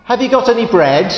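a cheeky duck asking again and again; pestering duck. Acted.

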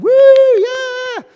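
high, loud crowd-style whoop; imitating a standing-ovation cheer. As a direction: whoop like an audience on its feet.

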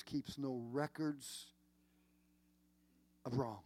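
"keeps no records" slowed down, then a pause before "of wrong"; deliberate weight on "keeps no records"; stressed phrase. Real, not acted. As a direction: lean on "records", slowly, and hold before "of wrong". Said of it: "I want to stress this".

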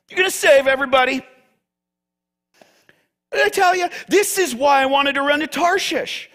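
high, accusing rant; angry yelling at God. Acted. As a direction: throw it back in God's face, fuming. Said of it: "Jonah's yelling".

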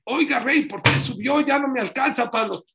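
high, loud pleading to the king; whining slave. Acted. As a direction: plead up high, a poor man short of money. (esclavo suplicando con voz aguda y fuerte)

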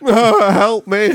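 put-on high wailing drunk plea; drunk crying. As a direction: wail it like a weepy drunk. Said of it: "crying drunk".

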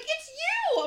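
high-pitched starstruck cry; excited fan. Retold or imitated. Fan recognizes the podcast host, excited. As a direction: imitate a fan spotting someone famous. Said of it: "that was so ex".

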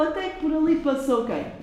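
ordinary delivery, nothing marked

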